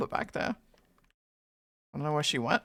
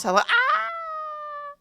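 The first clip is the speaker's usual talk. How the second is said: startled shriek; much slower than usual. Real, not acted. Sudden shriek mid-greeting.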